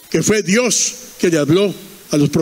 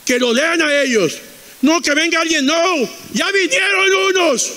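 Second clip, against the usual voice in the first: insist, higher with each phrase; emphatic insistence; 8 semitones higher than usual. Retold or imitated. Abraham refuses to send anyone, acted insistence rising high. Emphatic rebuke, climbing in pitch.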